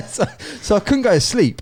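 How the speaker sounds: high, loud laugh through the words. Real, not acted. Telling mate about a sleepless night, laughing. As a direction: crack up while telling it.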